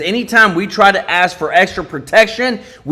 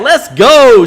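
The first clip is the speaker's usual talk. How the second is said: louder, higher hype shout; excited cheer; 4 semitones higher, 6 dB louder than usual. Retold or imitated. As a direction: read the chat like a rally cry. Reads out a viewer's super chat.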